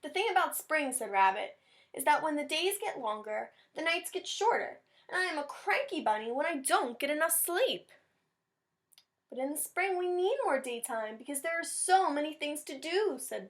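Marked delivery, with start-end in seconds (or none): 0.0-0.9 lightly raised, grumbling bunny voice; grumpy Rabbit. Acted. Teacher reads grumpy Rabbit to class, lightly higher. 1.9-7.9 higher, whiny rabbit voice; cranky bunny. Acted. Teacher voicing cranky Rabbit for the class, higher. Rabbit complains about spring. 9.3-13.2 higher, bright little bird voice; eager Bird. Acted. Teacher voicing keen Bird to the class, higher.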